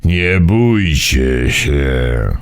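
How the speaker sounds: deep, slow elder voice; low old man voice. A narrator's reading. Old Mimo calms the frightened friends, low and slow. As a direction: speak slowly like an old man.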